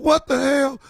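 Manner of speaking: high, loud imitation of a shocked cousin; mock disbelief. Cousin hears there is no water, mock shock. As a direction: squawk it in hammed-up disbelief.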